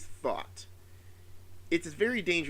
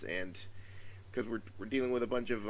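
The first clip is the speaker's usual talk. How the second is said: ordinary talk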